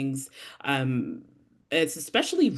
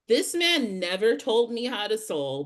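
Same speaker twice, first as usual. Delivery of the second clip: ordinary delivery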